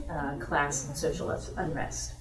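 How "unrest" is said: ordinary talk, nothing marked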